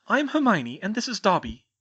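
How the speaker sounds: a hint of brisk schoolgirl; young witch. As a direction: Hermione inviting the elf, lightly voiced girl. Hermione introduces herself.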